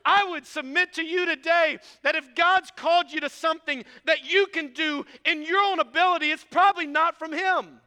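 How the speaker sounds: loud, high, climbing sermon delivery; full-force preaching. Acted. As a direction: drive it home to the congregation.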